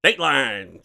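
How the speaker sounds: loud twenties newsman in Mid-Atlantic accent. Shown as a demonstration. Showing the old news guy voice, loud Mid-Atlantic accent. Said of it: "trying to nail home the more".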